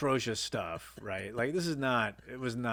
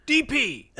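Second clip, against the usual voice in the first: short, high, explosive shout; imitated boss yelling; 14 semitones higher, 9 dB louder than usual. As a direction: yell it like a blustering director. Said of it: "this guy yells a lot".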